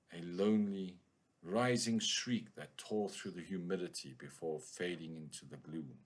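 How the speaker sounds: a touch of moody pulp-fiction narrator; noir story narration. A narrator's reading. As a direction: read it like a lightly played hardboiled detective monologue. Reads a noir story aloud.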